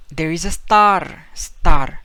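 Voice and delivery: put-on slow, loud Spanish-accented line. Teacher voicing a Spaniard calling out star in an accent.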